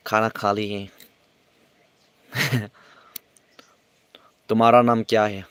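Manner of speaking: usual speaking voice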